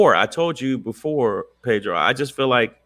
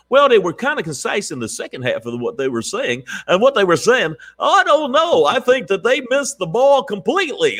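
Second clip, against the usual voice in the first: mocking pundits; 8 semitones higher than usual. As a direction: parody the TV commentators, rising high. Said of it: "people were trying to say".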